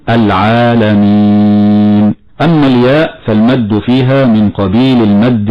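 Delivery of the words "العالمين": slow chant of العالمين with natural two-beat alif; tajweed recitation. Shown as a demonstration. Sheikh showing the natural madd to students, slow chant. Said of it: "فنقول".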